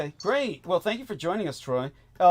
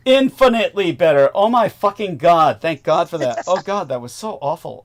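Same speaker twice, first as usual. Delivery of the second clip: relieved outburst; 5 semitones higher, 9 dB louder than usual. Real, not acted. Relief after bad audio fixed.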